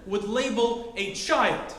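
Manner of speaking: usual speaking voice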